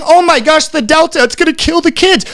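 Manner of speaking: mock the alarmist media. Vlogger mimicking panicked media about Delta, lightly voiced mock alarm. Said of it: "we have the media saying".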